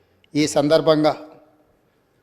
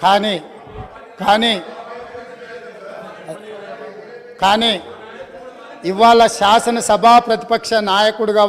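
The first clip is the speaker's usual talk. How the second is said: loud, higher-pitched political oratory; insistent, forceful speech, pitched up and loud; raised-voice rebuke; 6 semitones higher, 4 dB louder than usual. Real, not acted. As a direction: press the charge home over the chamber. Pressing on with rebuke in assembly.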